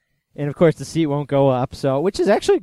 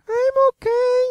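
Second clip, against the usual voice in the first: tiny squeaky falsetto; Steve Martin high-pitched gag. Retold or imitated. Retelling the movie's groin-hit gag, absurdly high squeak. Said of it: "high-pitched gag where Steve Martin's like".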